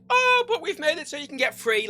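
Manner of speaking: mock excuse. Mimics officials' excuse, high.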